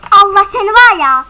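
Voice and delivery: loud angry outburst; angry shout. Real, not acted. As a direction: shout at the player in rage. Oyunda rakibe kızıyor. (öfkeli bağırış)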